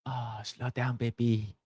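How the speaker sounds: Jackie Chan impression. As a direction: hush it low like Jackie Chan teasing. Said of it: "Jackie Chan goes".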